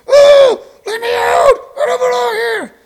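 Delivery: high, far-off pleading shout; trapped bobblehead cry. Pretend. Stuck on a shelf, begging to be let out, high shout. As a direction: call out high like a soul trapped inside a toy. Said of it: "sitting there on someone's shelf going".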